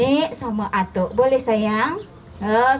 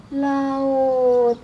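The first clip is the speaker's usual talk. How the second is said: plain reading voice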